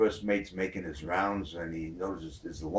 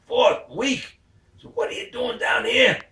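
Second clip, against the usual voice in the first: high, loud incredulous yelp; a loud squeal of disbelief; shocked disbelief; 8 dB louder than usual. Retold or imitated. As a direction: blurt it out, stunned by what he just heard. Shocked mate repeats her words.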